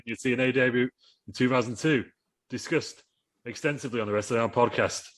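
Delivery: normal talking voice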